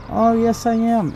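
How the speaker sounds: high, overeager imitation of a lying scammer; mock scammer voice. Mimicking the scammer insisting she's local, high and overeager. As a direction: squeak out a fake yes. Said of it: "scammer insisting, quoted".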